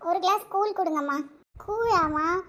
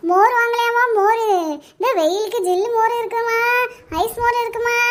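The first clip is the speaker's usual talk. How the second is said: singsong street hawker; 2 semitones higher, 5 dB louder than usual. Acted. Mother selling buttermilk in street.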